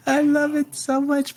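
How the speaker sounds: high-pitched, gushing approval; delighted. Real, not acted. Game master loving a player's in-character reply, real delight.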